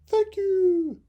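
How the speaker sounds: high, loud thankful chirp; grateful birds. Acted. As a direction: thank the boy like happy birds. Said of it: "sang the mother and father".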